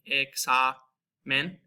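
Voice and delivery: "examen" slowed down, stressing the open A as in car. Shown as a demonstration. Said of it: "the A in car".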